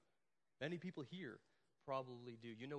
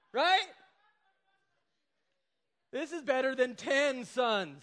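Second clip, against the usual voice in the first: much higher, louder, slow hammed-up brag; mock proud boast; 8 semitones higher, 12 dB louder, slower than usual. Pastor mocking husband who thinks he's enough, hammed-up loud brag. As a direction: play the clueless husband bragging loudly.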